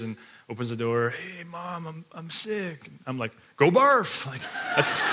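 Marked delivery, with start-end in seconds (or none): 1.1-2.9 put-on soft, high, slow little sick kid. Son waking his parents, soft and whiny. 3.6-4.3 high, loud exasperated "barf"; mock yell. Dad sending his sick son off, loud and exasperated.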